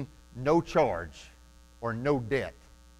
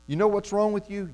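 whispering accuser; 6 semitones higher than usual. Retold or imitated. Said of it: "He will whisper to you".

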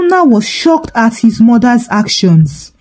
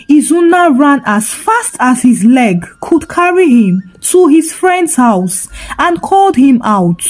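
plain reading voice

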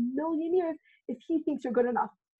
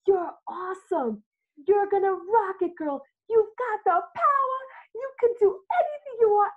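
mirror pep talk; 6 semitones higher, 4 dB louder than usual. Retold or imitated. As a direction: pump yourself up in the mirror. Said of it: "and I go".

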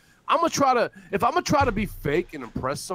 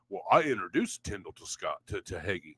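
lower, dopey mocking voice; low sneering impression again, softer at the end; mocking quote; 13 semitones lower, 6 dB quieter than usual. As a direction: drone it out like a dim whiner. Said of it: "I'm gonna fucking quote him".

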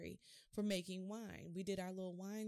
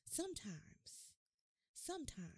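whispered sometimes; 6 semitones higher, 5 dB quieter than usual. Real, not acted. Preacher hushes the congregation before the miracle, whispering. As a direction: hush it, then lift it.

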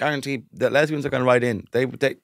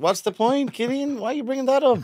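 mock outrage; 12 semitones higher than usual.

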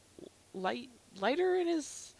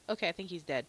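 normal talking voice

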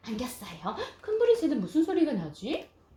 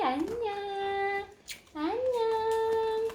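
sing-song goodbye; 8 semitones higher, much slower than usual. Acted. Waving goodbye to bird.

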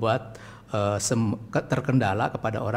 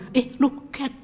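put-on cheerful talking to baby; 13 semitones higher, slower than usual. Shown as a demonstration. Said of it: "Tapi langsung aja".

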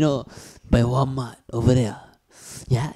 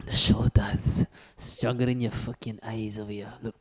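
whispered boast; 4 semitones lower, 6 dB quieter than usual. Real, not acted. Showing off his shoulders to a rival, whispered boast.

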